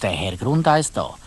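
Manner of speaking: ordinary delivery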